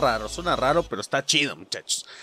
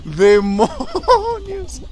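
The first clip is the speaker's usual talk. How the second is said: drawn-out lament; 11 semitones higher, much slower than usual. Real, not acted. Lamenta la eliminación.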